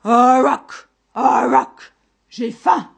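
loud owl-monster screech; monster's shout. A narrator's reading. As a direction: roar your hunger like a monstrous owl. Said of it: "cria-t-il au berger". (cri de monstre hibou affamé)

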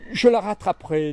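usual speaking voice

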